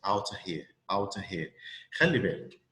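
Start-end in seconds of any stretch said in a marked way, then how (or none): 0.1-1.5 "out here" said like "outa here", of reduced to a; weak of as a. Shown as a demonstration. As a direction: run "out here" smoothly with a weak a. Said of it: "بننطقها زي الاي بالظبط".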